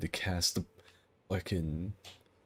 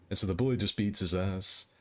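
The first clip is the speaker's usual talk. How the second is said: usual speaking voice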